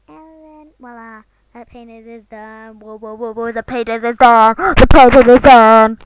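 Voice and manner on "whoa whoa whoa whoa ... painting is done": whoas building to a very loud yell; building shout. Real, not acted. Vlogger announcing the finished painting, building to a yell. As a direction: yell it louder and louder, dragging out the last one.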